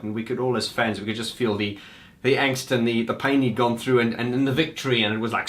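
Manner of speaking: normal talking voice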